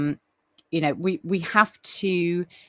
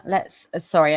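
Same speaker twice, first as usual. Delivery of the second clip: usual speaking voice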